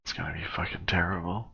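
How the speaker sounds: whispered aside under the breath; whispering. Real, not acted. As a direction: whisper it so the squad can't hear. Muttered aside while on comms.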